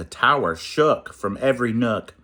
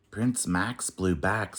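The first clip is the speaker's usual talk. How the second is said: plain reading voice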